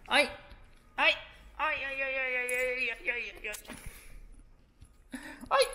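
high, alarmed string of ays; flustered cries. Real, not acted. Reacting to the camera falling over, high flustered cries. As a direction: yelp in a fluster as the camera tips.

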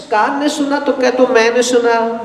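usual speaking voice

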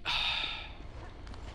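long breathy sigh; exasperated sigh. Real, not acted. Player fails the move, exasperated sigh. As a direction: let out a heavy sigh.

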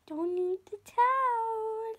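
slow high scolding. Real, not acted.

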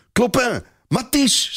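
high, joyful calling out of names; a boy bursting with happy news, calling his friends; excited shout. A narrator's reading. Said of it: "schreeuwt hij uitgelaten".

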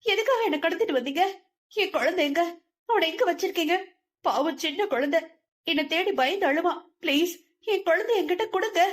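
put-on high, fast, desperate plea for her child; frantic pleading. A narrator's reading. Kidnapped mother begs captors for her child, high and frantic. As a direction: beg them to give the baby back. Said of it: "கத்தி கத்தியே".

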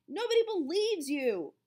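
high, loud put-down; annoyed dismissal. A narrator's reading. Calvin scolds Melvin.

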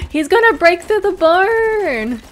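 high, loud panicked cry; alarmed shout. Real, not acted. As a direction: yell it out in alarm. Giant attacks barn.

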